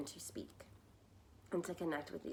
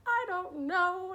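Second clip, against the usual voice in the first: slow, high, loud and tearful, lightly acted; retold sobbing; 11 semitones higher, 7 dB louder, slower than usual. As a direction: say it like you're mid-sob, imitating yourself. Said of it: "crying my eyes out".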